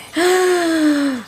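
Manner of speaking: big startled gasp for air; sharp waking gasp. Retold or imitated. Waking from the nightmare, a sharp desperate gasp. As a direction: gasp awake as if surfacing.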